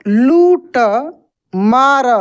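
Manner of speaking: लूटमार split into slow syllables, लू held long; slow syllable reading. Shown as a demonstration. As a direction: spell out लूटमार slowly. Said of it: "यहाँ पे लिखा है दोस्तों".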